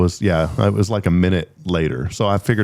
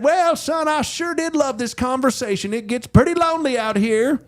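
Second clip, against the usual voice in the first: put-on very high, friendly old sheriff voice; Roscoe the cop; 13 semitones higher than usual. Lonely highway cop finally writing the ticket, in a very high voice. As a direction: lift it high and folksy, then deliver the bad news. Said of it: "he said".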